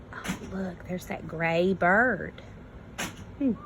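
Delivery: usual speaking voice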